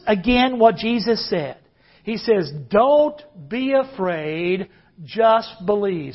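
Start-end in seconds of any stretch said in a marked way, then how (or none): 2.7-6.1 slow, drawn-out line for the crowd to repeat; preacher modelling the verse for the congregation, slow; slow demo. Shown as a demonstration. Slow, deliberate modelling. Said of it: "Say that with me".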